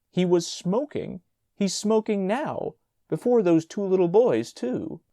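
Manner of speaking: out of breath and outraged; panting. A narrator's reading. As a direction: gasp it out indignant. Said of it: "Mister Brownell panted".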